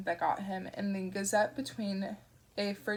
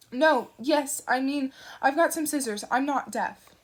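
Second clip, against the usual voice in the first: flustered; 5 semitones higher, 7 dB louder than usual. Acted. Flustered Newton replies.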